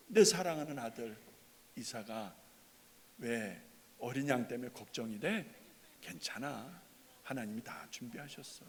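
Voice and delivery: put-on gently, like a father calming his boy; tender fatherly comfort. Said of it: "분위기가 아니었을까?". (다정하고 부드럽게 달래듯이)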